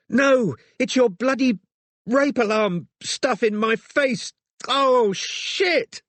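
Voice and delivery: high, slow, straining outburst; pained distress. A narrator's reading. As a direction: force it out slowly through pain. Injured woman in pain, explaining.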